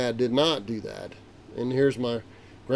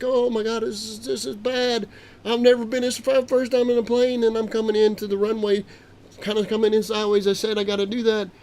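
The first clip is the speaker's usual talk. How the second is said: panicked; 9 semitones higher, 3 dB louder than usual. Retold or imitated. Panicked landing approach.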